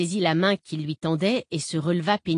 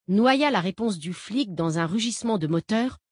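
plain reading voice

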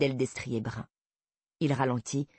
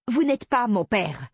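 louder, higher, slow indignant retort; raised voice, acted indignation; angry outburst; 6 semitones higher, 5 dB louder, slower than usual. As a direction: snap back, hitting each word hard. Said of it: "Oh là, du calme".

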